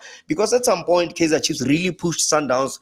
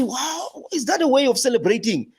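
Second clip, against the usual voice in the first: high, incredulous mock exclamation; mock disbelief; 11 semitones higher than usual. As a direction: act shocked at how they celebrate, pitched high. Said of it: "so, I say".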